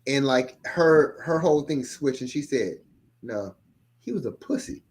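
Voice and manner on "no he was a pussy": low, soft retort, imitating her; quiet defiant quote. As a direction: deliver her comeback under your breath. Quoting her sudden cold turn.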